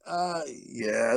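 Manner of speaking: low, soft sheepish mumble; awkward mumble. Retold or imitated. Caught kid answers mom about dinner, soft awkward mumble. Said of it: "He's like".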